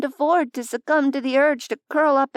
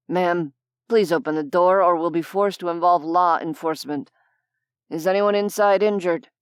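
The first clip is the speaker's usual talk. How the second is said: lower, firm official warning; stern manager voice; 5 semitones lower than usual. Acted. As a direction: threaten politely through the door. Manager through door.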